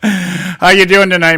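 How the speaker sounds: ordinary talk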